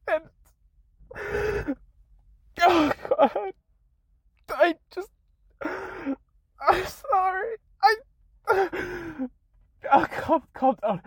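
high, very slow, tear-choked; choked sobbing. Real, not acted. Creator choking on tears apologising to followers, sobbing. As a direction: barely get the words out through sobs. Crying, then hyperventilating.

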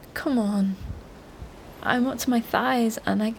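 usual speaking voice

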